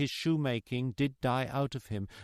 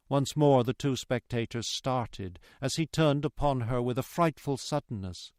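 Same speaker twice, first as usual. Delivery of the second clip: usual speaking voice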